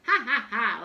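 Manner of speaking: loud, booming ha ha ha; lion laughing. A narrator's reading. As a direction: laugh it off like a big cat. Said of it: "laughed the lion".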